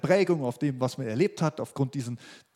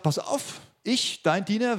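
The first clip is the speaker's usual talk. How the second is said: higher, mildly insistent acted declaration; bold offer; 7 semitones higher, slower than usual. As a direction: step forward and announce it, pitch lifted. Preacher voices David's bold offer to Saul.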